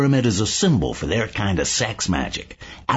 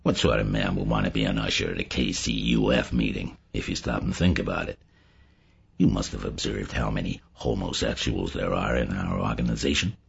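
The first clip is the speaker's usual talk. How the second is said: low, soft, confidential murmur; hushed, casual gossip; bland insinuation; 8 semitones lower, 6 dB quieter than usual. A narrator's reading. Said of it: "Joe went on blandly".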